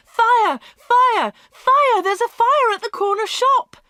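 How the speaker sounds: high, loud cries of alarm; alarmed shouting. Acted. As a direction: raise the alarm to the whole street. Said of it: "People were running and shouting".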